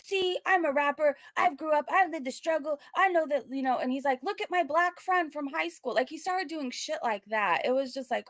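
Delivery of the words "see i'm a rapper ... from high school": mock wannabe rapper. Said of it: "he's one of those people who are like".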